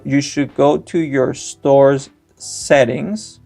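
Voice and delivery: usual speaking voice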